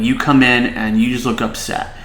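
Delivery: ordinary delivery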